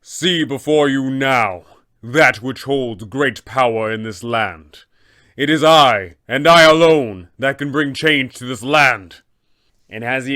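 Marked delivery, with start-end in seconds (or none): 0.0-9.2 slow, booming holy warrior, louder as he goes; a divine guardian asserting his power; threatening abbot. Acted. Abbot warns the party, in a slow booming threat. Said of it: "He will go ahead and respond in kind".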